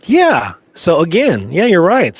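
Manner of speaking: usual speaking voice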